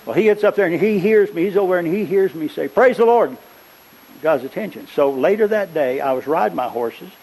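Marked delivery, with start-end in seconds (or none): 2.7-3.4 high, loud exclamation; raised, ringing holler of praise; retold praise shout. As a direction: call it out as he overheard it. Retells his earlier loud shout.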